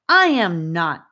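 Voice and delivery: loud, slow indignant retort; retort with feeling. A narrator's reading. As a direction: fire it back, slow and loud. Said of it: "Gabe retorted with feeling".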